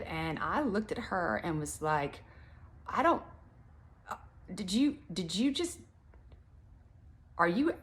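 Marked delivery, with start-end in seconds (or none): 2.9-7.7 lost for words. Retold or imitated. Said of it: "literally had a hard time finding words".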